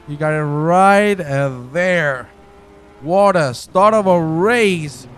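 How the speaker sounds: ordinary talk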